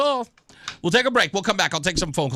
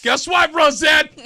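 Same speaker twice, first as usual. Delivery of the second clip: high, loud triumphant whoop; excited shout; 13 semitones higher, 8 dB louder, much slower than usual. Real, not acted. Host crowing to cohost Rosette, excited shout. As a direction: crow it out in pure delight. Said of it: "I get excited".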